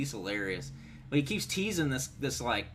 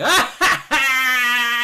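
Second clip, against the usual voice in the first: high, loud cackling laugh; loud burst of laughter; 13 semitones higher, 15 dB louder than usual. Real, not acted. Streamer laughs at girlfriend's dog bark, loud and high. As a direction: explode into real laughter.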